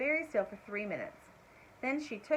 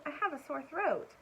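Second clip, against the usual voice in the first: bird voice; 6 semitones higher than usual. A narrator's reading.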